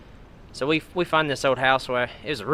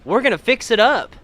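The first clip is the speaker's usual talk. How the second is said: loud, high-pitched fake enthusiasm; eager bluffing; 10 semitones higher, 8 dB louder than usual. Teens promising the owner a repair, eagerly bluffing. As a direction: sell the fib with over-eager cheer.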